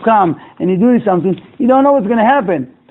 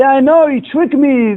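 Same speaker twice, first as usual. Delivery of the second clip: accusing yell; 7 semitones higher, slower than usual. Retold or imitated. Angry customer confronts the secretary.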